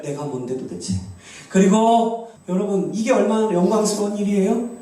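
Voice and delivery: ordinary talk, nothing marked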